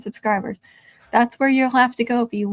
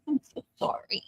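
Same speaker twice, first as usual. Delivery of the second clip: whisper; 2 semitones higher, 9 dB quieter than usual. Real, not acted. Comforting dog scared of fireworks.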